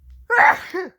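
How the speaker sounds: sudden real cough. Real, not acted. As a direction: coughs mid-story.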